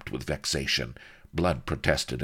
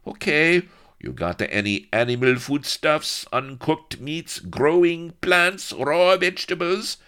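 put-on loud, raised voice with Spanish accent; truculent Spanish corporal; 10 semitones higher, 6 dB louder than usual. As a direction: interrogate them like a bullying customs guard. Sneering Spanish corporal questions captives.

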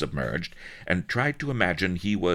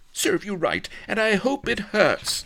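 high, spiteful retort; snapping. A narrator's reading. Vanity scolding the wounded pirate, high-pitched snap. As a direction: snap it back in a high lady's voice. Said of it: "snapped Vanity".

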